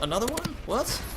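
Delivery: sudden high-pitched disbelief; surprised. Retold or imitated. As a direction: jump up in pitch, startled. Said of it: "my friends go".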